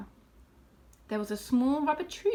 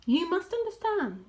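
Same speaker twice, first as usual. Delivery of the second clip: ordinary delivery, nothing marked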